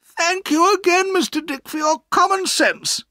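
high, loud, delighted thanks; happy. A narrator's reading. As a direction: thank him happily in a high, ringing voice. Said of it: "said my aunt happily".

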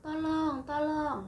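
high, loud plea for help; pleading for help. Retold or imitated. Asking for help. (minta tolong dengan suara tinggi)

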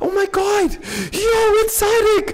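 high, loud squealing welcome; overjoyed greeting. Real, not acted. Streamer spotting his friend Sadiq in chat, squealing with joy.

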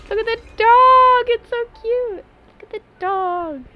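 gushing squeal. Real, not acted. Streamer gushing over a cute dog, high squealing coo. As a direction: coo it high, stretching the words.

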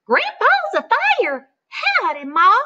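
a gosling greeting Jack as Ma; baby goose. Acted. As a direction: laugh it out like a newborn gosling. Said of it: "looked up at Jack and said".

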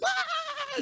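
very high delighted squeal; imitating daughter's happy scream; child's squeal. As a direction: squeal like a kid tossed in the air. Daughter's squeal thrown in air.

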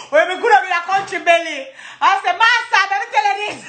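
high, excited Jamaican patois; hilarious excitement. Real, not acted. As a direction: exclaim it between fits of laughter. Excited boast between laughing fits.

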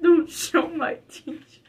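mock crying. Friend mocking the gamer's plea, mock crying. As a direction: fake sob, soft and whiny.

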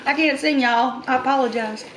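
ordinary talk

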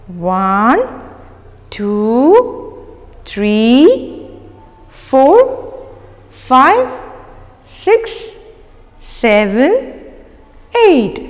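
put-on drawn-out rising count for kids; singsong counting. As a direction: count along like a kids' teacher. Counting aloud for children.

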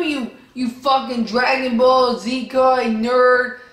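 ordinary talk

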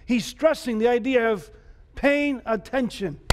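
usual speaking voice

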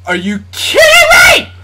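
real shout of disbelief; outraged yell. Real, not acted. Gamer shouting at an unfair play, outraged yell. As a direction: yell it at the screen.

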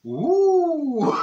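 mock ooh. Mock delight at abstract art.

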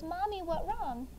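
put-on slow, soft little-kid question; a hint of a small worried child; toddler voice. Mother voicing her toddler asking what's wrong, slow and small. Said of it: "Hudson goes".

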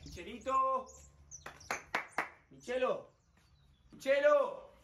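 calling a friend from afar. Acted. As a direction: shout his name across the house. Buscando a Michelo, llamándolo. (llamando a gritos)

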